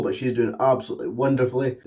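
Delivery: normal talking voice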